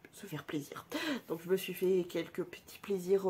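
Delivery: whispered aside to the viewers. Real, not acted. Vlogger whispering her small indulgence to viewers. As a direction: breathe it like a little secret.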